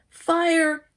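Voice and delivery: high, loud alarmed yell. Acted. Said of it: "yelled Jerry".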